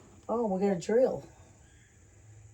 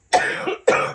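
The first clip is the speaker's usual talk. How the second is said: harsh dusty cough; coughing on dust; 11 dB louder than usual. Real, not acted. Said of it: "the dust is getting to me".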